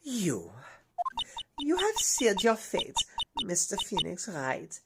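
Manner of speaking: put-on menacing whisper in a German accent. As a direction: hiss the threat, German accent. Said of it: "a German accent instead of Russian now".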